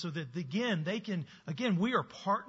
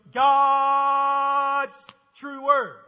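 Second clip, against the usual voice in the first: put-on big, slow, high chant for little kids; loud, drawn-out, sing-song call-and-response; preschool call-out; 8 semitones higher, 12 dB louder, much slower than usual. Shown as a demonstration. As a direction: belt it out slowly like leading a room of toddlers. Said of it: "we do it a little different in preschool".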